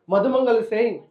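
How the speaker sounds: normal talking voice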